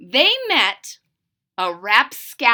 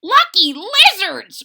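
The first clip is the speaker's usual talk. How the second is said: put-on high, loud cracked old-man voice; excited old man croak; croaky old man; 8 semitones higher, 7 dB louder than usual. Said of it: "croaked the old man".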